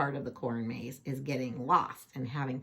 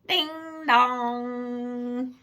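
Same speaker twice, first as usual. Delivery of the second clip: doorbell imitation; 7 semitones higher, 6 dB louder than usual. Doorbell rings.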